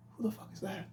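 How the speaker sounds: hushed, breathy whisper; whispering as his twenty-year-old self; whispered younger self. Retold or imitated. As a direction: whisper it like a puzzled kid. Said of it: "Twenty would be like".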